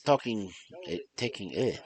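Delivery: storytelling in a put-on French accent; French-accented storyteller, meant to be German. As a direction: read the tale aloud with a makeshift French accent. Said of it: "Why am I doing a French accent".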